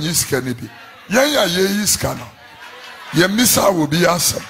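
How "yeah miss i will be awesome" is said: usual speaking voice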